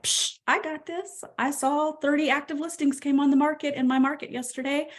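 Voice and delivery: self-assured agent waking up cocky; confident swagger. Pretend. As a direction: sell it like nothing can stop you. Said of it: "Wake up and be like".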